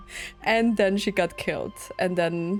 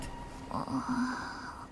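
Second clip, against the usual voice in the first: long breathy gasp; soft, drawn-out gasp of awe; 3 semitones higher, 13 dB quieter than usual. Real, not acted. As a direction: gasp in amazement. Reacting to character reveal in cutscene.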